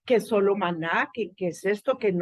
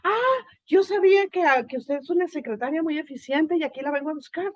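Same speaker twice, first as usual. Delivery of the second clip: mock eager employer; 6 semitones higher, 3 dB louder than usual. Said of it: "te van a decir".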